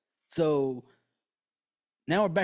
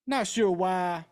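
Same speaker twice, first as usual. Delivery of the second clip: ordinary delivery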